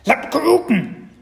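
very high, loud, drawn-out squawk; squeaky, enthusiastic creature voice; thrilled baby dragon. A narrator's reading. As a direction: squeal with delight at a treat. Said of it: "sagte Spekulatius begeistert".

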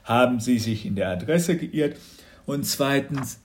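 sleepy father talking through a yawn. A narrator's reading. Father woken at night to officers, yawning objection. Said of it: "Papa gähnte".

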